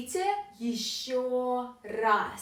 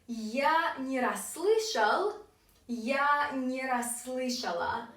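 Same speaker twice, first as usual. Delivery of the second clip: slow, stretched-out teaching pronunciation; slow model phrase; slower than usual. Shown as a demonstration. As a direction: draw it out for learners. Teacher slowly models both forms.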